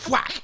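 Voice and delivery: sudden loud high "Whack!"; cat smack. Retold or imitated. Friend retelling cat fight, loud high mock whack. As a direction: yelp the smack like a cartoon hit.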